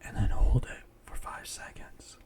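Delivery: slow, mostly whispered breathing count; guided breathing meditation. Acted. Guide a friend through breathing in and out, hushed meditation voice.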